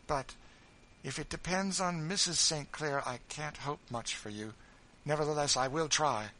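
firm kindly promise; Miss Ophelia. Acted. Miss Ophelia promises Tom help, lightly voiced brisk lady.

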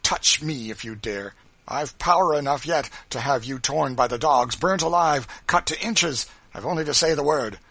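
loud, rising defiance; rage and scorn. A narrator's reading. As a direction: dare them with blazing contempt. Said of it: "blazing with rage and scorn".

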